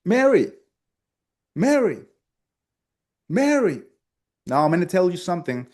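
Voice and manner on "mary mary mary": high, loud, falling call of Mary; tonality demo. Shown as a demonstration. Coach demonstrating tonality to students, high calls. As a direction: sing out Mary with a bright falling tune. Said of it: "Okay, tonality".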